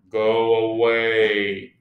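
low, slow grumble; unseen sleeper's voice. A narrator's reading. Toad in bed shooing Frog away, low slow voice. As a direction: send the visitor off, low and slow. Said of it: "said the voice".

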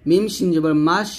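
ordinary talk

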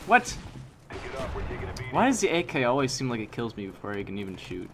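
ordinary talk

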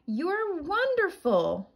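high, slow delighted praise; admiring wolf. Acted. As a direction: marvel at her skating. Wolf admiring, catching her.

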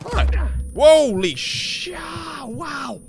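high, sudden, loud fright; startled shout. Real, not acted. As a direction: jump at it and yell. Startled by enemy behind door.

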